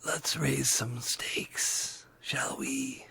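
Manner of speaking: slow eerie whisper of a riddler; whispering sphinx. Acted. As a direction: breathe the riddle out slowly, menacing. DM voices masked skeleton.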